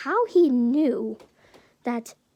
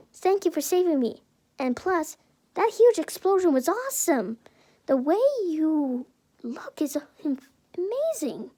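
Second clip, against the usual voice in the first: higher-pitched, admiring gush; gushing compliments; 4 semitones higher than usual. Acted. Oraka thanking and complimenting Izuku, gushing. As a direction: gush in a raised, excited voice. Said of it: "complimenting Izuku by his looks and his strength".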